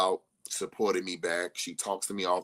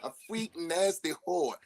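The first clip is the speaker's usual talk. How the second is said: laughing; 9 semitones higher than usual. Real, not acted. Joking insult amid laughter.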